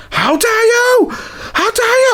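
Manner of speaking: high, drawn-out mock shriek; mock yelling. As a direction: imitate her outraged yell, pitched sky-high. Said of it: "Why are you yelling?".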